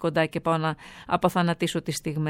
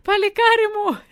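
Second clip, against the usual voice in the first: very high, loud, drawn-out proud cheer; Greek mother cheer; 14 semitones higher, 5 dB louder, slower than usual. Retold or imitated. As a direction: holler it like a proud mum at a parade. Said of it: "και είχε το κλασικό".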